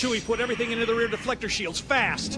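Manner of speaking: fast, high, urgent command to the copilot; urgent order. Acted. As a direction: bark it out under fire, quickly. Urgent order during a dogfight.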